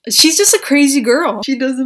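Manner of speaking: loud, punchy emphasis; emphatic. Real, not acted. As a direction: belt it out with conviction. Raving about the film.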